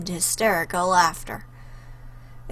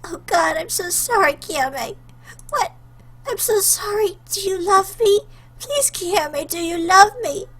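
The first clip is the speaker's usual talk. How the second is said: tearful apology; 10 semitones higher than usual. Acted. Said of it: "Between her sobs and hiccups".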